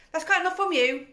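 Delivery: high, loud flustered snap; snappy mother. A narrator's reading. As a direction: cut her off, embarrassed. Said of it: "Missus Wormwood said".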